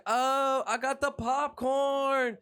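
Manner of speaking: high, drawn-out cartoon cheer; Mordecai. Cartoon blue jay announcing snacks, high slow sing-out. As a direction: stretch each word up high. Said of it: "and he's like".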